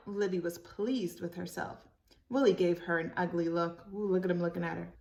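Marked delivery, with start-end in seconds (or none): none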